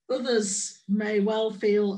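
usual speaking voice

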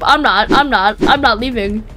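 usual speaking voice

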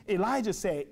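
ordinary talk, nothing marked

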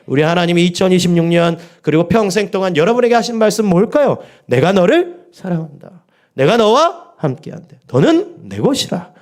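preacher calling out God's promise; fervent preaching. Acted. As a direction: proclaim it with full conviction.

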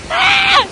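sudden high yelp as the cold wave hits; startled shriek. Real, not acted. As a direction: shriek of shock at cold water.

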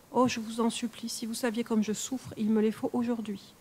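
ordinary delivery, nothing marked

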